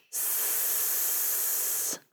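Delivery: long unvoiced sh breath out; shushing exhale. Shown as a demonstration. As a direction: blow the air out on a steady sh. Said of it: "breathe out the air with a sh".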